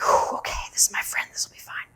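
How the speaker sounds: unvoiced nervous whisper; whispered pep talk. Meditator steeling herself beside a silent friend, whispering. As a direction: whisper courage to yourself.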